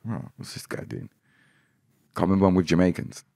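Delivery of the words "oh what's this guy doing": very soft, unvoiced aside; low puzzled murmur under the breath; imitating a muttered doubt. Onlooker wondering about this guy, hushed mutter. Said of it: "to be like".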